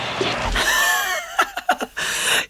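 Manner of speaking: high, helpless laugh; real burst of laughter. Real, not acted. Host reacting to a dated line in the clip, bursting out laughing. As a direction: crack up laughing.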